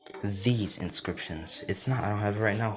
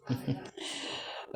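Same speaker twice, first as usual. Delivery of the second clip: gloating chuckle; 6 semitones higher, 9 dB quieter than usual. A narrator's reading. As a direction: chuckle quietly to yourself over the win. Deku gloats over reduced opponent.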